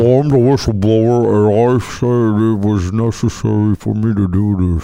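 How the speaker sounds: disguised deep voice. Pretend. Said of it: "Is your voice disguised".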